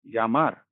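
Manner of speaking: यामार said with a clear y sound, yamar; यामार, the ll spoken as य; Spanish ll as y. Shown as a demonstration. Teacher modelling यामार with a y sound for students. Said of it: "तो इसको हम यामार बोलेंगे".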